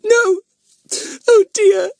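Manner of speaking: Hilary sobbing that her home was burgled; weeping girl. Acted. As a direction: wail it through tears. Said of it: "wept Hilary".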